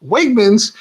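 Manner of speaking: sudden high squeak of disbelief, mock outrage; shocked disbelief. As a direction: pitch jumps way up on the name. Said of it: "I was like".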